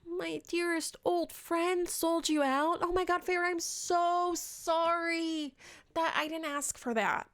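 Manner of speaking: mock sorry Tamlin. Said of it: "and Tamlin's like".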